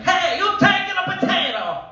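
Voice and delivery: put-on high, loud old Italian nonna; Italian grandmother. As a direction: shout remedies like a pushy grandma. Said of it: "My grandmother would chime in like".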